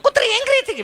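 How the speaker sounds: high, affected shocked city lady; mincing posh tourist. Retold or imitated. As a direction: gasp it out like a pampered lady. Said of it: "ચીપી ચીપીને બોલે". (ચીપી ચીપીને નવાઈથી)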